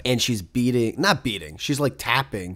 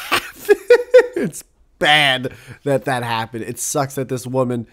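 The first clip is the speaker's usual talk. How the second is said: usual speaking voice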